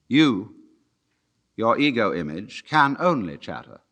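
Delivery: ordinary talk, nothing marked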